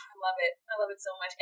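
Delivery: dreamy sigh. Real, not acted. Swooning sigh after outburst.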